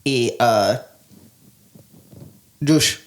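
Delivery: put-on slow, hesitant Greek accent. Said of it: "how do you do a Greek accent".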